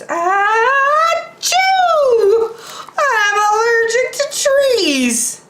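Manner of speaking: high polar bear sneezing then complaining. Acted. Polar bear sneezes in the trees, high-pitched.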